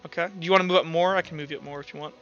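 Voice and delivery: normal talking voice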